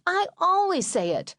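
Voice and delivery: put-on high kid voice, indignant; whiny little brother. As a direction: pipe up like the youngest kid insisting. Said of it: "my youngest brother Bruce said".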